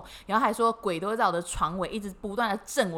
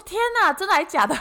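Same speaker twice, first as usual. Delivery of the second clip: higher, louder shocked exclamation; startled disbelief; 8 semitones higher, 4 dB louder than usual. Retold or imitated. Startled customer reacting to the rice-ball vendor, shocked. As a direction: gasp it out in surprise.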